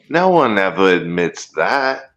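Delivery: ordinary talk, nothing marked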